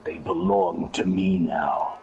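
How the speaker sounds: low, slow, eerie whisper; creepy whisper. Retold or imitated. Mysterious voice on the call, low slow creepy whisper. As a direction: whisper it like a ghost. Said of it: "And then you hear".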